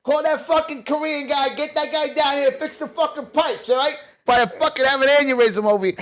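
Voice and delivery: a hammed-up Italian rant about the pipes; angry Italian guy. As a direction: bark at Marie like a hot-headed Italian. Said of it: "I'm like".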